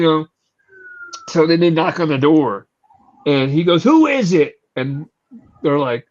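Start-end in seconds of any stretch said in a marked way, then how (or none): 3.8-4.6 angry. Retold or imitated. Angry comic answering the door.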